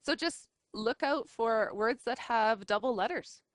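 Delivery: ordinary talk, nothing marked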